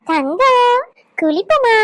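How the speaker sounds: put-on bright sing-song call to the baby; baby talk. Grandmother inviting the baby to a bath, high sing-song coo.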